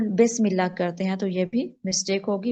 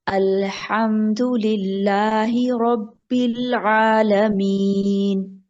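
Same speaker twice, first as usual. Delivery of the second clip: slow Quran recitation, long vowels; much slower than usual. Shown as a demonstration. Teacher reciting for Saadia to repeat. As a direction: recite it slowly for the student.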